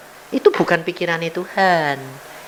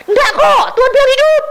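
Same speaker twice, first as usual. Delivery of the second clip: insistent shout; 4 dB louder than usual. Retold or imitated. Uncle insisting to the doctor.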